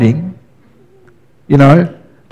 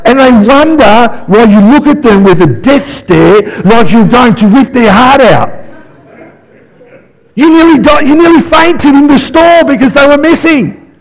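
usual speaking voice

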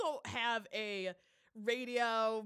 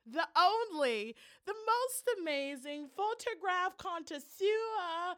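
slow, high build-up introduction; hype announcer intro; 4 semitones higher, 3 dB louder, slower than usual. Acted. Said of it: "your radio voice game is".

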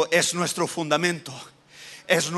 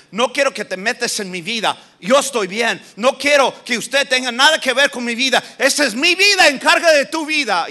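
starts defiant, ends shouting higher and louder; rebellious youth building to a yell; 6 semitones higher, 6 dB louder than usual. Acted. Preacher voicing a teen telling parents to butt out, building to a shout. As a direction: let the defiance swell into a shout.

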